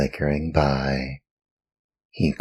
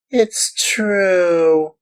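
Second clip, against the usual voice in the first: even higher, louder wailing repeat; wailing in tears; 5 dB louder than usual. Acted. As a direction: let the plea break up into a wail. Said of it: "cried Pinocchio in tears".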